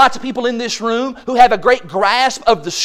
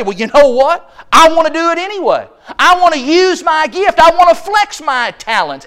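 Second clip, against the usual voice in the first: defiant insistence; 4 semitones higher, 5 dB louder than usual. Pretend. Voicing a defiant member.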